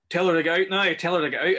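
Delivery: loud, high, urgent repeated order; retold shout. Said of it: "is shouting".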